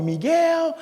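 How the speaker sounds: name sung out high and long; high, stretched attention-getting call; mother's emphatic call. Retold or imitated. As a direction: holler for a child from another room. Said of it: "my mother called me".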